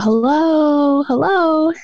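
high, drawn-out call into the woods. Retold or imitated. Kids calling to an unseen voice by the creek, high call. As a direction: call out to someone you can't see. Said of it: "we started to call out".

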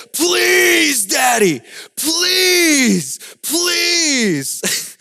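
high, drawn-out mock wail; demanding toddler tantrum. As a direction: imitate a small child shouting to get his way. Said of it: "He wasn't begging me".

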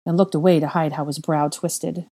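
ordinary delivery

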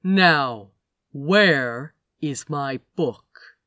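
very slow, weighted demand; slow and clear. Acted. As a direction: drag each word out with menace. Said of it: "very slowly and very clearly".

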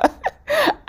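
short high-pitched giggle; amused laugh. Real, not acted. Speaker laughing at their Joey imitation, high giggle. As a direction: giggle at your own impression.

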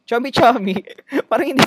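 mock the word chummy-chummy; mocking sing-song. Shown as a demonstration.

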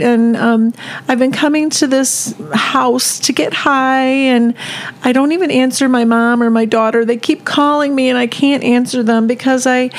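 tearful, pleading retelling in a crying voice. Retold or imitated. Imitating a desperate woman confessing from the street, crying voice. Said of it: "do it with a crying voice".

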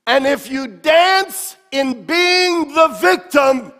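loud, high, shouting preacher; preaching at full force. Acted. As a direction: build it to a roar.

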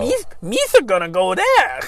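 high, loud goofy alien voice; Jar Jar Binks voice. Retold or imitated. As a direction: squawk it out like a clumsy alien. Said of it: "the Jar Jar Binks' voice is the most obnoxious".

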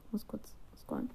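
quick whisper to herself off the script; real whisper; whispered aside. Real, not acted. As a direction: drop to a whisper for a side note. Reader's whispered aside while reading.

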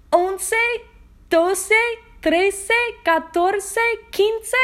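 energetic Spanish count. Shown as a demonstration. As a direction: call the numbers out with energy. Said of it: "Let's try that in Spanish".

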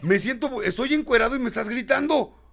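high, laughing-through-words protest; nervous laughing. Retold or imitated. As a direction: laugh nervously while complaining. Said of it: "en el piso de la risa, pero de nervios".

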